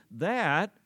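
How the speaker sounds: "that" rising high and loud; stressed that. Shown as a demonstration. Preacher repeating the key word to the church: punched "that". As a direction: lean on the word "that". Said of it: "I emphasize the word T H A T".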